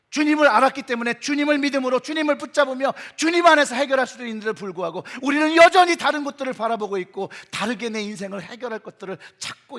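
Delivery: preaching at full force. Real, not acted. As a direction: drive each point home to the congregation. (힘주어 외치는 설교조)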